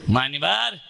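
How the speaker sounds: grave preacher's warning; solemn sermon. Acted. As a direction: lean in and warn the congregation.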